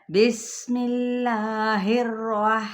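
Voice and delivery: بسم اللہ الرح- very slow, with only one or two taps on the ra; light trill on ra. Shown as a demonstration. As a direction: recite الرح- with a minimal trill. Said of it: "تو کیسے ہوگا؟".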